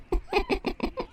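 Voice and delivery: high, stifled little giggle; giggle behind a hoof. A narrator's reading. Twilight laughing at Cardus's joke, stifled giggle. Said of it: "Twilight laughed behind a hoof".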